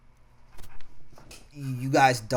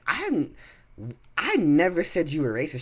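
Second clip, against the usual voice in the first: indignant denial; 6 semitones higher, 5 dB louder than usual. Retold or imitated. Retold argument, defending himself.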